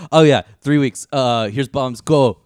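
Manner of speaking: loud, high, bad German accent; movie villain German accent. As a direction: bark it like a cartoon German officer. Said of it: "I'm sorry for the bad German accent".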